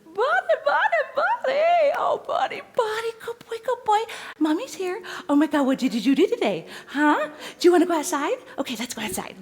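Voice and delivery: mock owner coming home, shrieking with laughter at her dog; gushing dog owner. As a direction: gush over the dog like an overexcited owner. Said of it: "come in the door and go".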